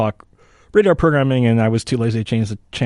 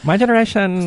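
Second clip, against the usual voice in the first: high-pitched parody of a millennial; mock millennial voice; 9 semitones higher than usual. Joking over how to read the question, millennial voice. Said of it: "in a millennial voice".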